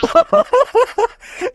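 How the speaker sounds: long falling laugh; real amused laughter. Real, not acted. Laughs at the quick ending.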